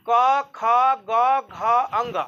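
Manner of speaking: very slow, each letter drawn out; drawn-out teacher recitation; slow demo recitation. Shown as a demonstration. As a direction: recite the letters slowly for the class to feel. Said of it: "कंठ पकड़ के देखिए".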